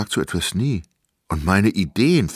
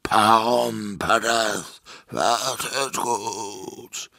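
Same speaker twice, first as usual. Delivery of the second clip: giant calling slowly from far up; voice from high above; 3 semitones higher, much slower than usual. A narrator's reading. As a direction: draw every word out like a distant giant. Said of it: "von weit oben seine Stimme".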